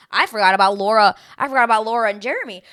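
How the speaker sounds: loud, fast burst of surprise; sudden realisation. Real, not acted. Realising mid-recap she forgot a couple, loud and surprised. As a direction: blurt it out as it hits you.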